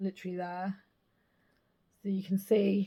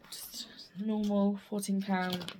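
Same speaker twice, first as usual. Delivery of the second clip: whispering; slower than usual. Real, not acted.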